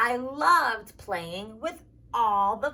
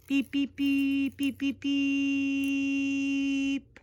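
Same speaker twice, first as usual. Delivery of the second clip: steady monotone beeping, drawn out; one-note beeps with a long last one; imitated tractor beeps; slower than usual. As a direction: beep like a tractor driving off. Imitating the tractor driving away.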